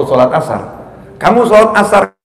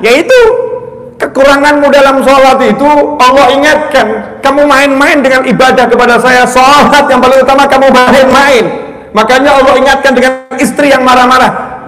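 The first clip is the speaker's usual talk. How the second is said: raised, loud sermon delivery; full-force preaching; 8 semitones higher, 4 dB louder than usual. Acted. Preacher urging congregation to check their bond with Allah, forceful preaching. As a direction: drive the warning home to the congregation.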